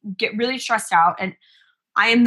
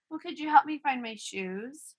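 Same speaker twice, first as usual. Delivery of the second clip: put-on soft, higher-pitched little kid voice; imitated small child; 5 semitones higher, 8 dB quieter than usual. Son asking mom to find his shoes, small voice. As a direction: ask timidly like a young son.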